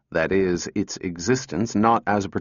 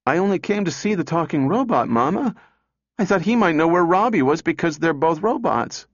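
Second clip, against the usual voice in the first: grieved raised voice; 7 semitones higher than usual. A narrator's reading. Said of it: "raised her grieved voice over the din".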